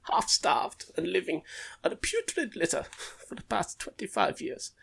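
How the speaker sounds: pretend German person. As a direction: read it as a hammed-up German. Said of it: "We'll just pretend a German person sent it over".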